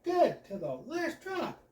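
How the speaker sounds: high, slow, overeager grinning read; hammed-up cheesy salesman; forced big smile. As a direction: smile until your cheeks hurt. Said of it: "I smile until my cheeks hurt".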